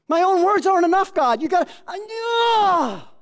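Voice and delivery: high, loud, breaking cry to God; groaning prayer. Shown as a demonstration. Preacher showing private tongues, a loud breaking cry. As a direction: strain till words fail, then cry out. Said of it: "That's tongues".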